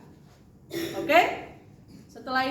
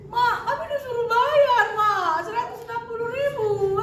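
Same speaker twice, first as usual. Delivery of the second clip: put-on higher, louder voice of a distressed girl; panicked girl; 10 semitones higher, 5 dB louder than usual. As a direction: act the frightened student on the phone. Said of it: "udah mau nangis-nangis dia". (suara anak panik)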